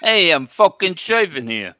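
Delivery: hammed-up gangster shout; mock tough-guy yell. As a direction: bark it like a wiseguy mid-shave. Mock tough-guy shaving bit.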